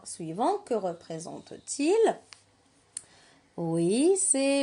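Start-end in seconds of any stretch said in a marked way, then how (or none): none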